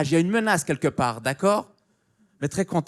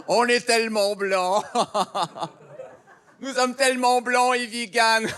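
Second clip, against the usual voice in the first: posh vegan voice; 5 semitones higher than usual. Retold or imitated. Mocking the vegan restaurant crowd, airy and giddy. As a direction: gush like a dreamy vegan hipster. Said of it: "c'est comme".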